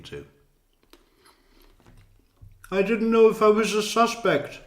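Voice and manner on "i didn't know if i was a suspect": mock witness whine. Commentator parodies witness answer.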